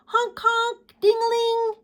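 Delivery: high playful honk and ding; reading bike noises to children, high and playful. Acted. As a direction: sing out the bike sounds.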